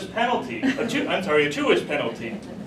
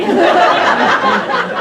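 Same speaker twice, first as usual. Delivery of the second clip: high, loud burst of laughter; 12 semitones higher, 10 dB louder than usual. Real, not acted. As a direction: crack up. Teller laughs at own joke.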